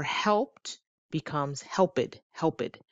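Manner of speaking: helped said as help-id with an extra syllable; wrong id ending. Shown as a demonstration. Teacher imitates the student error, slowly. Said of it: "That's not correct".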